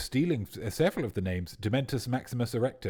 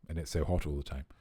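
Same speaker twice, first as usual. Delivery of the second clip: normal talking voice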